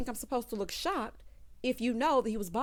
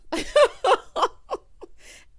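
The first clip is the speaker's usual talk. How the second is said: high shrieking laugh, real; loud laughing fit; 14 semitones higher, 9 dB louder than usual. Real, not acted. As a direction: break up laughing loudly. Host bursts out laughing.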